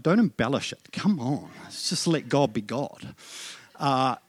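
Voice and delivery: ordinary delivery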